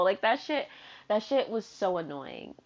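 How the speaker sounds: ordinary talk, nothing marked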